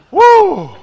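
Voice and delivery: high loud woo; excited whoop. Real, not acted. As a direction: whoop out in delight. Exclaims after chant.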